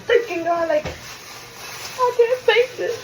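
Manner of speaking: high, slow, half-laughing sob; mock crying wail. Mother in labor tells doctors she can't take it, mock sobbing. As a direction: ham up the crying in labor pain. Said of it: "I'm sitting there crying".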